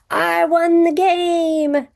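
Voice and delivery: high, loud and slow, like shouting a victory; excited exclamation. Shown as a demonstration. Teacher showing an exclamatory sentence, excited cheer. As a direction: cheer it out slowly, thrilled.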